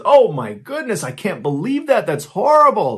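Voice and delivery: high, loud put-on horror; overacted gasp of disbelief, faking innocence; mock shock. Dennis feigns shock to police.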